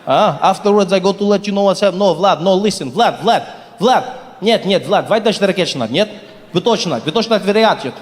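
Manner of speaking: fast mock Russian phone call, half English half Russian; imitating a Russian man barking into a phone; Russian accent. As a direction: play a Russian guy on the phone setting up a deal. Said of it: "then he switched into Russian".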